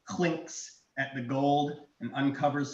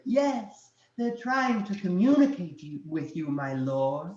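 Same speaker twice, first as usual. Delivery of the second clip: high-pitched fawning minion; small servant voice; 5 semitones higher, 3 dB louder than usual. Acted. Dragon answering himself as his minion, small voice. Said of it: "you hear the small voice go".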